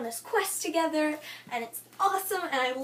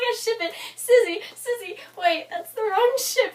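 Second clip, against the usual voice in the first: higher, louder replay of gushing over a couple; excited fangirl; 6 semitones higher, 5 dB louder than usual. Retold or imitated. Fan retelling her reaction to the characters, excited. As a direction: imitate your own eager shipping outburst.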